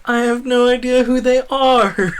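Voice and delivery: high, loud mock-exasperated voice; comic cluelessness at strangers' names; baffled aside. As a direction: squeak it out, utterly lost. Own baffled reaction, acted out.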